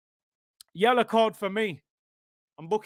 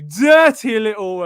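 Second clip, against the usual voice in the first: quoted mock shout; 7 dB louder than usual. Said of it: "Thingy would say".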